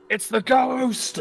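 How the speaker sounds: high, loud, drawn-out cry of alarm; yelling guard. Acted. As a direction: play a panicked guard raising the alarm. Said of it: "you hear three of the guards yell".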